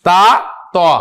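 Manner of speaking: ता and then तो, the vowel rounded toward o; rounded Arabic ta. Shown as a demonstration. Quran teacher showing students the rounded letter, careful pronunciation. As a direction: round the lips so ता becomes तो. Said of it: "इसको जरा थोड़ा सा तो".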